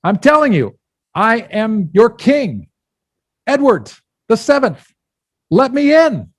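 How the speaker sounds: high, loud royal command, hammed up; indignant king. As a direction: proclaim it emphatically and demand entry. Affronted king insists on entry.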